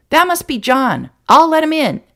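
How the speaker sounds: put-on high, loud eager kid; bursting to open the door; boy rushing to greet a friend; excited boy. A narrator's reading.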